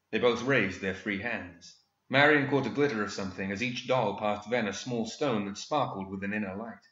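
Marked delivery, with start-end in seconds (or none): none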